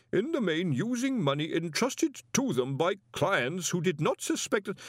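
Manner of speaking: timid professor forced to read; nervous stammering reader. Acted. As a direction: stumble nervously through the pages. Nervous professor reading aloud.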